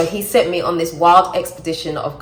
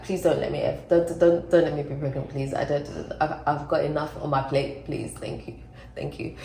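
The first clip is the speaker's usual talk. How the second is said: half-joking plea; 2 semitones lower, 6 dB quieter than usual. Pretend. Begging not to be pregnant after joking, quiet mock prayer. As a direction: plead under your breath, fading softer.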